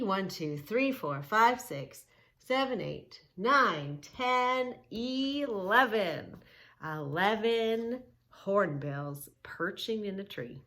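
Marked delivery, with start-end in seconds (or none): 0.0-6.4 put-on lively up-and-down counting for kids; sing-song counting. Shown as a demonstration. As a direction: count along like a preschool teacher.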